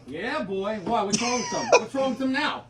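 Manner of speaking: brash Italian-American guy bickering in a sketch; hammed-up Italian-American accent, swearing and mocking. Acted sketch with Italian voices.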